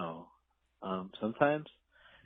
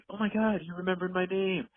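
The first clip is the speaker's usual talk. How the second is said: much higher, louder gushing; delighted surprise; 8 semitones higher, 5 dB louder than usual. Retold or imitated. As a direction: gush like they're flattered. Retold delighted acquaintance.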